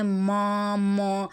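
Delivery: ما، مو drawn out as round "maw, mo"; students' rounded vowel. Retold or imitated. Teacher imitating students' mistake, ما، مو over-rounded. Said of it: "بالکل اس کو گول کر جاتے ہیں".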